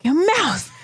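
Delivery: high, playful snap; mock outrage. Cutting off teasing with shut up.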